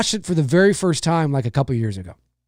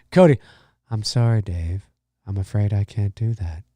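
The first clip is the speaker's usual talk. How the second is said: deadpan machine voice refusing; HAL 9000 impression; 8 semitones lower than usual. Streamer quoting the movie computer, low and calm.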